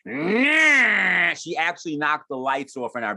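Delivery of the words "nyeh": high loud mock buzz; loud buzzing vibrator noise. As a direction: imitate a roaring vibrator. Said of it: "really loud, like".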